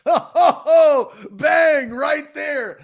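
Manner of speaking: high, loud, laughing whoop; excited cheer. Real, not acted. Host cheers the guest's finished impression, loud and elated. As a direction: burst out cheering in delight.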